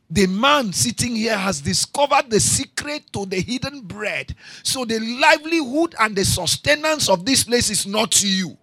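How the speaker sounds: sermon building in force; preaching. Acted. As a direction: proclaim it to the congregation. Preacher rising again.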